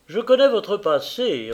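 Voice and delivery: loud, stern accusation, stress on connais; severe tone. A narrator's reading. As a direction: hammer it home like a judge. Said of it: "repris-je d'un ton sévère".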